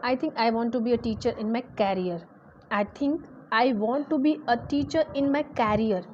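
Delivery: slowly voiced answer, clearly spaced; a lightly acted learner answering; slow student reply. Teacher acting a student's reply, slow and deliberate. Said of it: "सामने से जवाब आता है".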